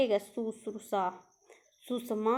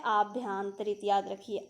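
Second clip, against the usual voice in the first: ordinary delivery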